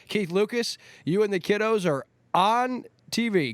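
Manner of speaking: ordinary delivery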